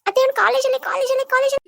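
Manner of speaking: high, loud, whining calls of ಅತ್ತೆ; whiny tattling call. Acted. Niece calling aunt to tell on her cousin, high whining shout. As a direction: bleat for auntie like a tattling child.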